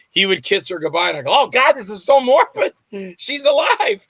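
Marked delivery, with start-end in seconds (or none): 1.3-4.0 higher, incredulous outcry; mock-appalled exclamation, pitched up; exasperated disbelief. Reacting to staged fake funeral.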